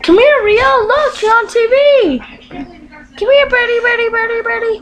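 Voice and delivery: high-pitched birdie call; high, eager pet call. Real, not acted. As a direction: coax the bird over in a high voice. Calling the pet bird over.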